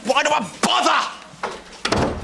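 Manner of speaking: high, drawn-out cry of exasperation; exasperated outburst. Acted. Angry man left without his breakfast, exasperated. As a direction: cry it out, fed up.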